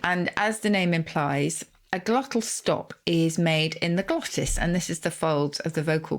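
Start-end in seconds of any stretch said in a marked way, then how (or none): none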